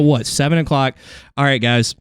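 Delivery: ordinary delivery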